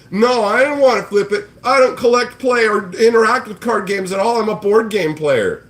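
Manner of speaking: high, loud mock whine; mocking whiny flipper. Streamer quotes the flipper's replies, high whiny mock. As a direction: sneer it as a defensive whiner. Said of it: "just being like".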